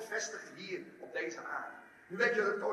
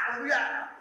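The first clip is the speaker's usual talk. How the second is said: loud, high hallelujah with a laugh; joyful exclamation; 5 semitones higher, 4 dB louder than usual. Retold or imitated. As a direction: burst out in overjoyed praise. Acts out joyful street dancer. (uitbundig juichend halleluja)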